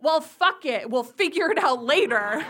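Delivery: loud, dismissive dad retort; acted exasperated dad. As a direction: overrule her with loud impatience. Dad snaps back, acted out.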